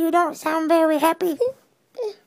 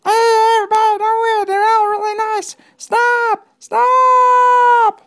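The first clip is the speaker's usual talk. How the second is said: louder, higher shout; calling out; 6 semitones higher, 6 dB louder than usual. Acted. Ralphie shouts after the fleeing kids, loud call. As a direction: yell to stop them.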